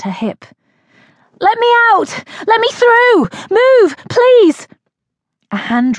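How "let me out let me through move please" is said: high, loud desperate yelling; a trapped woman shouting to be let out, panicked. Acted. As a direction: scream to push through the crowd. Trapped in a crushing crowd.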